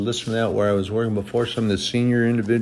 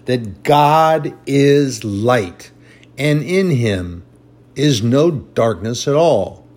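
emphatic scripture reading; 2 semitones higher, 4 dB louder than usual. Acted. Preacher reads First John to the congregation, emphatic scripture reading. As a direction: read it out firmly, weighting each phrase.

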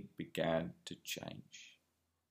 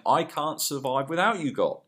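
higher, pleading prayer voice; David's prayer; 8 semitones higher, 3 dB louder than usual. Retold or imitated. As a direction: cry out to God like the psalmist. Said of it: "David was saying".

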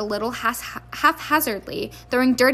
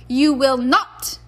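loud, slow, stern refusal; snapped; 2 semitones higher, 7 dB louder, much slower than usual. A narrator's reading. Mother forbidding her son bed, snapped. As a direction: forbid it firmly, leaning on each word. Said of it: "snapped Missus Weasley".

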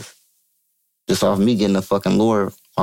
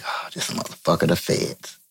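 low, under-the-breath retold thought; hushed realization; 4 semitones lower, 6 dB quieter than usual. Newly freed man privately deciding his driver is a fed, hushed. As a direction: say it softly to yourself, pitched down.

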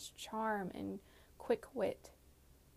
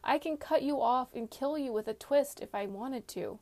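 higher, louder angry threat; snapping; 5 semitones higher, 6 dB louder than usual. A narrator's reading. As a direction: snap back, forceful and raised. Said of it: "she snapped".

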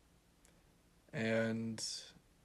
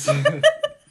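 high laugh; 16 dB louder than usual. Real, not acted. Laughing at own archaic phrasing.

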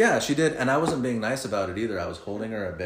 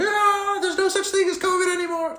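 carefree partygoers; 5 dB louder than usual. Retold or imitated. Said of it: "everyone's just like".

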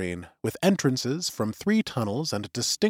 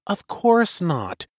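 slow, higher caring woman's voice; concerned young woman; 6 semitones higher, much slower than usual. A narrator's reading. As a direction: ease the denial out gently. Suri voiced, slow and high.

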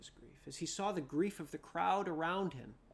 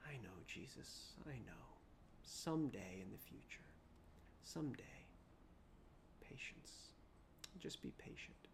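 lower, hushed whisper of grief; grieving whisper; 6 semitones lower, 12 dB quieter than usual. Acted. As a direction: breathe it out, resigned and defeated. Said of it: "with a sigh of grief".

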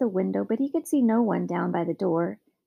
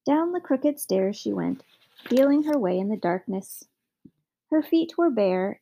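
ordinary delivery

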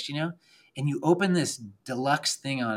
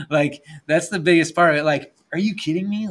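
higher, louder, gushing with delight; excited; 6 semitones higher, 8 dB louder than usual. Real, not acted. Telling the host chairlifts beat skiing, excited. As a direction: sell how amazing it is.

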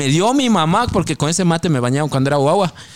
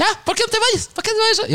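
very high mock wife nagging; high nagging wife impression; wife's voice. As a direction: nag in a high voice. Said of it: "me dice".

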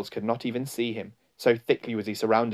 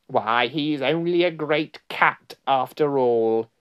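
slow, raised creature voice; mocking follower, slow and higher; jeering; 4 semitones higher, 4 dB louder, slower than usual. A narrator's reading. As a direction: draw it out in scornful surprise. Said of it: "jeering at him".

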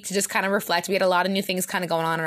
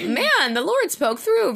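higher, drawn-out awestruck quote; retold amazement; 9 semitones higher, 3 dB louder, much slower than usual. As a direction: imitate being blown away, pitched up. Retelling her amazed reaction to the recording.

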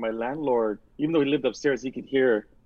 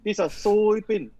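mock Chinese-accented chef telling a pun; Yan Can Cook impression; 8 semitones higher than usual. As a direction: do the TV chef's punchline voice. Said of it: "like, his joke".

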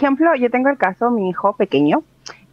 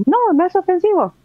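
reassuring correction; 8 semitones higher than usual. Retold or imitated. Mother telling apologetic strangers it's fine, high insistent. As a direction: brush off the apology, pitched up. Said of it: "Y es como".

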